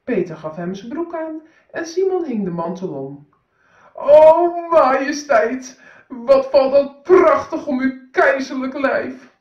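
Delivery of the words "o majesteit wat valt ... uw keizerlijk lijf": high, loud, oily flattery for the emperor; butter up the emperor grandly; fawning swindler. Acted. Swindler flatters the emperor about his new clothes, fawning and loud.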